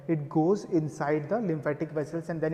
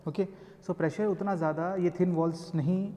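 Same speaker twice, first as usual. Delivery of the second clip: ordinary talk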